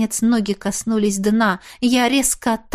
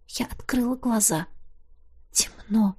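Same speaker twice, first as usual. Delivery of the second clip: soft unvoiced whisper; 8 dB quieter than usual. A narrator's reading. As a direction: breathe the word out. Waking blindfolded in the dark.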